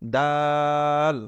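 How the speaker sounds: "দাল" with a very long, level "aa"; model pronunciation. Shown as a demonstration. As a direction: stretch the vowel of "দাল" slowly. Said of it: "কি উচ্চারণ করব?".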